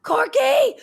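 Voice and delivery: high, loud excited cheer of Corky; shouting Corky. Retold or imitated. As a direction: cheer like a fan at a concert. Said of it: "and he's going".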